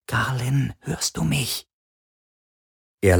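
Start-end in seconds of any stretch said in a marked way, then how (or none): 0.1-1.7 high, soft tiny woman's voice; tiny female whisper. A narrator's reading. Tiny drone checks whether the prisoner hears her, high and hushed. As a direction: call softly and high, like a tiny creature. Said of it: "eine winzige weibliche Stimme flüstern".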